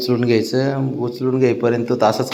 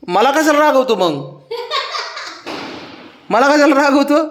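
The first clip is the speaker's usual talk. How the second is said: much higher, coaxing pet voice; playful pet-talk protest; 12 semitones higher than usual. Real, not acted. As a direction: protest playfully in a high sing-song to your pet.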